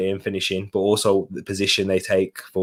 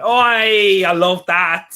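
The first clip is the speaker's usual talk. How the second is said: high, loud cheer of approval; thrilled, high-pitched exclamation; excited delight; 7 semitones higher, 4 dB louder, much slower than usual. Real, not acted. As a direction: burst out delighted. Delighted reaction to accent verdict.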